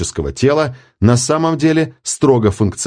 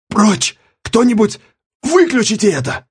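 hoarse; 12 semitones higher than usual. A narrator's reading. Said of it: "прохрипел Мисарж".

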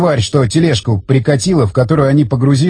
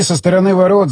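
ordinary delivery

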